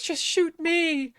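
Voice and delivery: Peeta's noble plea played as slow melodrama; mock melodrama. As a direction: ham up a noble self-sacrifice, drawn out. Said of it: "he's like".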